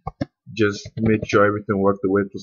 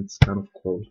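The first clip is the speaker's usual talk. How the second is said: usual speaking voice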